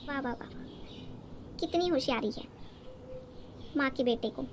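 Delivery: slow breathy sarcastic praise, almost whispered; sarcastic clapback. Acted. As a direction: drag out each वाह like a slow sarcastic clap. Sarcastic wife mocking husband. (धीमी फुसफुसाती तंज़ भरी वाह)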